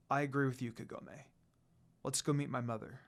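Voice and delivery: a reserved lord opening his heart quietly; tender confession. A narrator's reading. Sesshomaru agrees.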